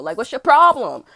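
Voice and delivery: slow loud yell; shouted challenge. Retold or imitated. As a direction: bellow the question at him.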